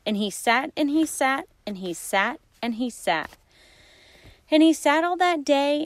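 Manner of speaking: plain reading voice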